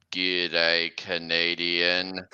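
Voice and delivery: very low, drawn-out monster greeting; killer freight car. Acted. Possessed freight car greets its prey, very low slow voice. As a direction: drop deep and stretch it slowly. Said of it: "It's like".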